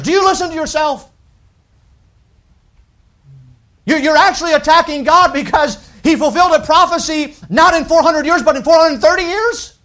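high, loud, incredulous rebuke; incredulous preaching. Acted. Preacher scolding the skeptics, high, loud and incredulous. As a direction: challenge the critic in disbelief. Rebuking critics at full force.

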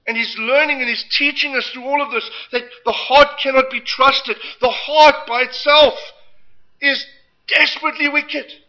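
fervent preacher building loud; preaching. Acted. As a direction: preach it out with force. Preaching at full force.

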